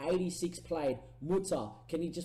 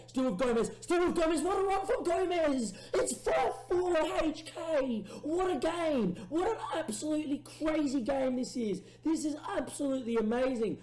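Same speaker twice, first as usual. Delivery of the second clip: much higher and louder building goal call; ecstatic commentary; 12 semitones higher, 4 dB louder than usual. Real, not acted. Gamer commentating a winning run, ecstatic. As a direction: scream it as the run ends in a goal. Gomez runs through, goal.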